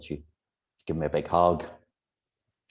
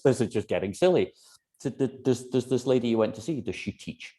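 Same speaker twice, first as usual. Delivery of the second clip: ordinary talk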